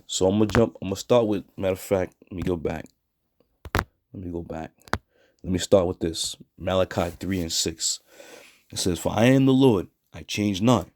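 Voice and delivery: normal talking voice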